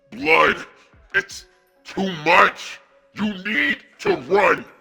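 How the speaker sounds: put-on a boy losing control, straining; pained strain. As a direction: grit through the agony. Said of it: "obviously in extreme pain".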